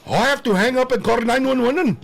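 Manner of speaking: high, fast, loud mock panic; mock panicked caller. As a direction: squeal it out like a frightened clerk. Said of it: "He goes".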